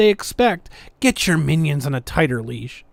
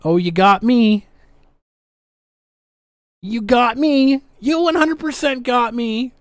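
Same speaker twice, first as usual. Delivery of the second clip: sarcastic concession; 5 semitones higher than usual. Acted.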